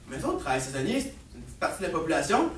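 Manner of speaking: low, soft, quick aside; understated son reasoning with his father; calm reasoning. Acted. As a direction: make the point quietly and quickly. Son calmly argues his point.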